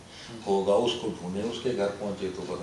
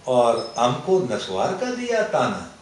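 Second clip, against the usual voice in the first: put-on slow Pashto-accented recitation, rising to a loud finish; 6 dB louder than usual. Poet building to his paan punchline, Pashto accent. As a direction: draw out the couplet in a Pashto accent. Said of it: "میں پڑھتا بھی اسی لہجے میں تھا".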